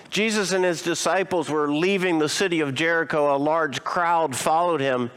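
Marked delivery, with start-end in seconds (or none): none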